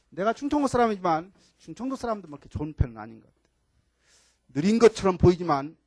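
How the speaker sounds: normal talking voice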